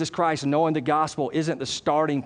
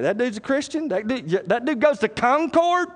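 incredulous unbeliever; 7 semitones higher than usual. Acted. Unbeliever's disbelief, acted by preacher.

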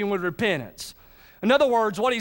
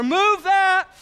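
higher, louder group shout; crowd shout all together; 10 semitones higher, 5 dB louder than usual. Retold or imitated. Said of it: "Let's get it all together".

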